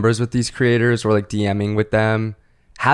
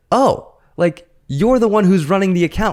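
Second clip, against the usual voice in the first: excited; 8 semitones higher than usual. Retold or imitated. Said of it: "And I was like".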